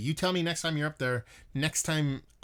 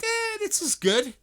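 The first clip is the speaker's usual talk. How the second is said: squeaky high, slow mock-satisfied "Eh"; smug approval; 5 dB louder, slower than usual. Mocking himself for settling on a cheap Pilsner four pack, in a squeaky smug voice. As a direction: play a self-pleased beer snob in a silly high voice. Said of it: "And you're like".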